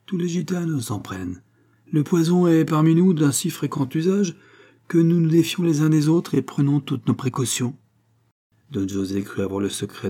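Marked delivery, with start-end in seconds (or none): 0.1-7.8 higher-pitched gitana voice; Fatima explaining, insistent. A narrator's reading. Fatima justifying the antidote to Don José, insistent higher voice. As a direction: lift the pitch, press the explanation.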